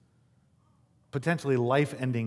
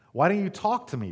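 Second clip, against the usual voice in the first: higher-pitched, demanding question; 7 semitones higher, 3 dB louder than usual. A narrator's reading. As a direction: read it as an exasperated demand, mildly. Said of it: "Pilate demanded".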